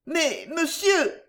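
high, drawn-out startled protest; surprised exclamation. A narrator's reading. Young woman to stranger at the stove, surprised. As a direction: exclaim in surprise, pitched well up. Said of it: "de la surprise".

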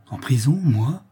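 mildly dumbfounded, taken aback; stunned disbelief. A narrator's reading. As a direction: ask it in understated shock. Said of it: "frappé de stupeur".

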